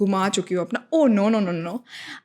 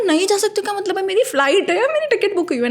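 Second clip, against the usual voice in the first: much higher, insistent disbelief; incredulous protest; 9 semitones higher than usual. Retold or imitated. To the official refusing boarding, incredulous high protest. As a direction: push back, voice climbing.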